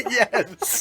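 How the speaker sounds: laughing hard, high-pitched; wheezing laugh while talking; laughing through pain. Real, not acted. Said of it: "Oh, that hurts so bad".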